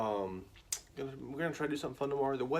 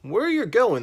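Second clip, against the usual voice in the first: higher, loud call to the traders; 11 semitones higher, 14 dB louder than usual. Acted. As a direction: shout across to the caravan. Brothers calling to traders.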